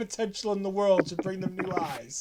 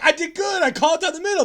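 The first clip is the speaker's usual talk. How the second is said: Ali insisting, higher and louder; self-satisfied Ali; 9 semitones higher, 6 dB louder, faster than usual. Retold or imitated. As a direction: protest proudly, pitched up. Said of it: "He's like".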